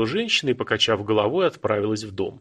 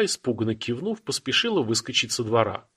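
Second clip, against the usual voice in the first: ordinary delivery, nothing marked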